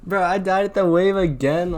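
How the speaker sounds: high, loud exasperated yell; frustrated shout. Real, not acted. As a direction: shout at the game. Dies again, frustrated.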